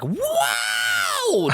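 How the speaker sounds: very high squeaky droid "Wow!". Retold or imitated. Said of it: "assume is the R two D two, like".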